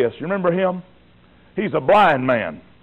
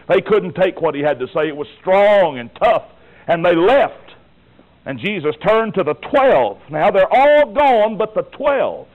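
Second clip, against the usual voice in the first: fiery preaching; 5 semitones higher than usual. Acted. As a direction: pound the point home from the pulpit. Preaching at full force.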